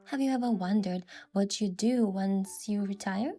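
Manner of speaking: normal talking voice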